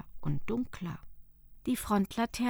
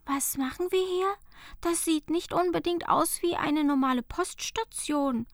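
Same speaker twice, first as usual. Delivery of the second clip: put-on higher, wondering girl's voice; puzzled question; 7 semitones higher, 3 dB louder than usual. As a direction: ask it puzzled, as Fenja. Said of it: "fragte Fenja verwundert". (Fenja fragt verwundert)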